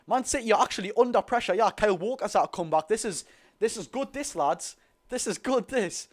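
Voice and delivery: high and loud, thrilled by the press; excited cheering. Real, not acted. Streamer thrilled as Newcastle pressure City, excited. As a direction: cheer it on as your team takes control.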